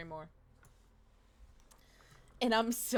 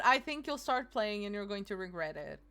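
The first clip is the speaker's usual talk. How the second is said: normal talking voice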